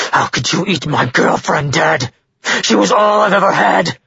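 anguished outburst building to high, tearful fury; grief-stricken fury rising in pitch; boiling rage. A narrator's reading. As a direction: rage at him, breaking into a cry. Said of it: "His rage boiling over".

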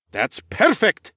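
light up with joy; delighted. Acted. Nikolaus delighted by answer.

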